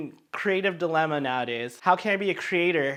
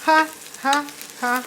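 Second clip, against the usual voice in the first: high, spaced-out mock ha; separate, high puffs of put-on laughter; imitated TV-show ha; 10 semitones higher than usual. Cook imitating The Office over aromatics, high ha. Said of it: "do, um, The Office".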